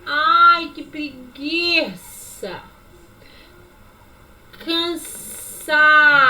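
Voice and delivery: lazy and slow. Shown as a demonstration. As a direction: sound too tired to move. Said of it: "Movimento relaxado". (preguiçoso e bem arrastado)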